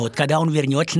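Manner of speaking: ordinary delivery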